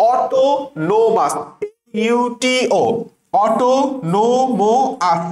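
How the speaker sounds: অটোনোমাস stretched out slowly as au-to-no-mous; spelling pronunciation. Shown as a demonstration. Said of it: "অটোনোমাসের উচ্চারণ কি করবা".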